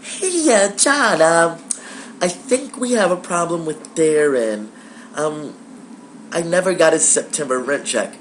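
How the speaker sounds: high, slow, hesitant sweet-lady voice; sweet landlady. Retold or imitated. Landlord phoning the tenant about missing rent, sweetly hesitant. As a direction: put on a gentle, high-pitched woman's voice. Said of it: "sweetest woman in the world".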